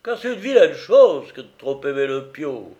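loud, tipsy, rambling sacristan scolding his friend; a sozzled old man mock-lecturing a pal, loud and loose; drunken sacristan.

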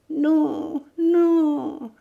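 high, pained pleading; imploring. Acted. Old woman begging the bandit, imploring. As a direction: beg the torturer to stop. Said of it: "implora-t-elle".

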